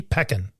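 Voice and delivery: PECAN as PECK-un, short E; low, soft Pecan said PECK-un; sitting on the E. Shown as a demonstration. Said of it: "sit down on that E".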